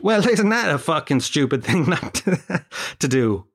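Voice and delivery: usual speaking voice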